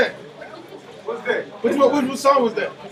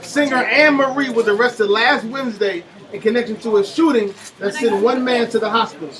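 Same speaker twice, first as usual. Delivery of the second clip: ordinary talk, nothing marked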